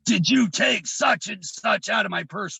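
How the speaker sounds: high, forceful angry demand; enraged mother. Retold or imitated. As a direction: imitate a raging mother's accusing interrogation. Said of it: "gives me that look".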